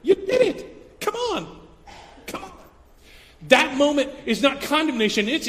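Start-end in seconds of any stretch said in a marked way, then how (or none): 0.0-2.5 very high, bright encouraging coaxing; Jesus coaxing Peter with high encouragement. Retold or imitated. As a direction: cheer him back on warmly. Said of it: "he's looking at him going".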